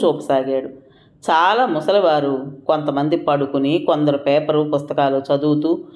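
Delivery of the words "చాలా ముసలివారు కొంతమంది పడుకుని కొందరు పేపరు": usual speaking voice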